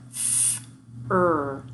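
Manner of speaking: "fur" split into "f" and "er"; segmented sounds. Shown as a demonstration. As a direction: say "f" and "er" as two separate sounds. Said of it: "broken up into".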